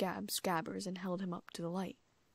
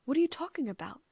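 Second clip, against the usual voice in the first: confused; 7 semitones higher, 7 dB louder than usual. A narrator's reading.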